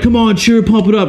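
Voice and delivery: high, pumped-up mock crowd rallying; hammed-up pep rally call; imitated hype man. Hype men working up the crowd.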